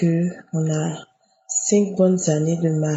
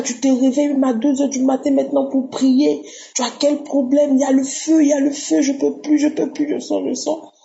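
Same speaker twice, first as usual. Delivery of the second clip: high, urgent cries of a tormented spirit; possessing spirit's big voice; 7 semitones higher than usual. Retold or imitated. Woman retelling the spirit crying out through her, high agitated big voice. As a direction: cry out as the spirit being driven out, pitch raised. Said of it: "j'ai commencé à crier une grosse voix".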